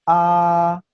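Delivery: "A" held high and level; loud, drawn-out level "A"; first tone. Shown as a demonstration. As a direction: sustain "A" on one high, unwavering note. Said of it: "el primer tono suena".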